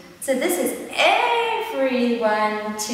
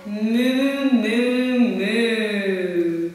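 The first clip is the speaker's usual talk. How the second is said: cow mooing; 3 semitones lower, much slower than usual. Acted. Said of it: "The cow says".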